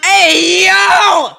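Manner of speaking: a crying-out shout, way up high and loud; outraged shouting. Real, not acted. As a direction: yell it out like you can't believe it. Outraged reaction to the teen mocking her.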